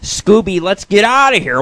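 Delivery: lightly mimicked panicky catchphrase; Shaggy. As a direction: yelp it like a spooked cartoon stoner. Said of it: "Shaggy always said".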